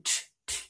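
unvoiced t, t; whispered t. Shown as a demonstration. Teacher isolating the t of translation. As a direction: whisper the t before it. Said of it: "So translation".

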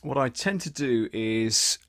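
ordinary talk, nothing marked

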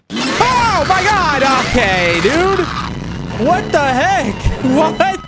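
high panicked shriek; terrified scream. Real, not acted. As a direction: scream after the jump scare. Jump scare in game.

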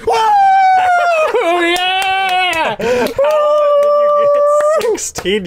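excited shout. Real, not acted. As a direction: crow over the win at the top of the voice. Winning a Mario Party minigame.

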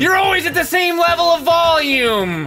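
TV salesman voice. Shown as a demonstration. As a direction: sell it at full volume, never dropping. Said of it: "the trick to being a two thousands, uh, T V salesman".

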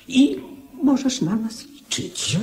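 eager pledge. Acted. Minister pledges support to king.

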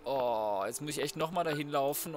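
ordinary talk

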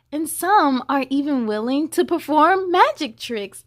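put-on lively read-aloud to children; story reading. A narrator's reading. Reading the hippo book to children, lively storybook voice. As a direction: read it bright and sing-song for kids.

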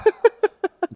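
short high-pitched laugh; quick high chuckle. Real, not acted. Host laughing.

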